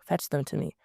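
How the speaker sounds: usual speaking voice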